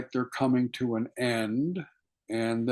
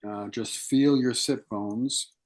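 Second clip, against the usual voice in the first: guided meditation. Acted. Teacher guiding students to feel their sit bones, calm meditation voice. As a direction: ease listeners into their seated bodies, unhurried.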